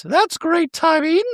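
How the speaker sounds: high, prim voice for Dorit; Dorit impression. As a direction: play Dorit sweetly apologetic in a high voice. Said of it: "Dorit goes".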